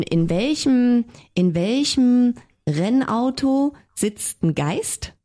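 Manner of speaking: slow, stretched riddle question; drawn-out riddle. Real, not acted. Friend stretching out the joke setup slowly.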